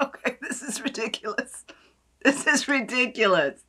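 amused laughing delight; giggly disbelief. Real, not acted. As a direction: laugh through it. Laughing at the scent.

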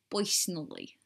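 New Jersey "poysonally", oy for er; New Jersey accent. Shown as a demonstration. British vlogger showing a favourite word, New Jersey accent. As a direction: say personally with a thick New Jersey oy. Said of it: "more of a New Jersey accent".

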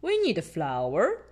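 lightly voiced dad pig, slow; a hint of a jolly father listing things; Daddy Pig voice. A narrator's reading. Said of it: "Daddy Pig says".

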